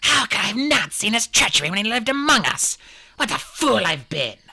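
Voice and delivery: high, loud, anguished hiss; forceful, rasping outburst; bitter self-reproach. A narrator's reading. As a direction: rage at your own blindness. Leader rages at her own blindness.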